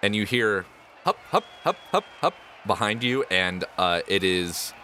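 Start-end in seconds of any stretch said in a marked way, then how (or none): 1.3-2.0 high rhythmic hup calls; jogging chant. Acted. Goblin clowns jogging in place, rhythmic hupping. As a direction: bounce the syllables like a jog.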